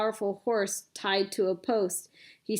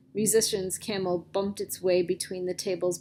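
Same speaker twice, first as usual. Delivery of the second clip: ordinary delivery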